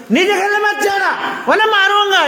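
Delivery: high-pitched demonstrated angry telling-off; angry papa scolding. Shown as a demonstration. Teacher shows children how papa scolds, acted angry. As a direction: raise the pitch sharply, threaten like a cross parent. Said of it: "जैसे गुस्से से बोलना".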